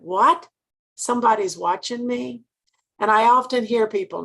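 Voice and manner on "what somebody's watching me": incredulous question, rising sharply on the first word; taken aback, a little louder; retold astonishment. Director learning staff watch her unit, surprised.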